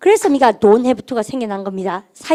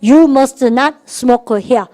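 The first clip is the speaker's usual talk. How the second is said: each word stretched very slowly, a bit louder; slow drill; 4 dB louder, much slower than usual. Shown as a demonstration. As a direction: drag it out for the class to copy. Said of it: "시작".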